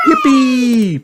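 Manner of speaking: high, loud squeal of joy; excited shout. A narrator's reading. Peppa off to jump in puddles, shouting. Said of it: "Peppa shouts".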